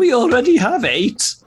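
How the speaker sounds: put-on high plummy posh accent; sniffy posh lady voice. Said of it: "put on a posh voice".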